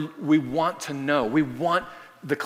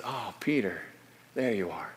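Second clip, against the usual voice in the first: tender recognition; 4 semitones lower, 6 dB quieter than usual. Retold or imitated. Blind boy recognizes Peter.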